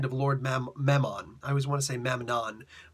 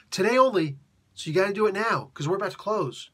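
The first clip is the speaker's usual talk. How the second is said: higher, louder hard sell; pushy sales pitch; 5 semitones higher, 5 dB louder than usual. Retold or imitated. Clown hustling a kid into staying, loud pushy sell.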